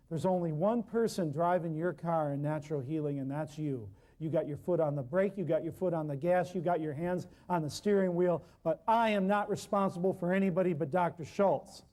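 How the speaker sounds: ordinary talk, nothing marked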